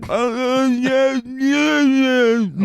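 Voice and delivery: high, loud, wordless strained syllables; a crude mock deaf voice. Asked for a deaf impression, high strained mock voice. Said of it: "I can do a deaf impression".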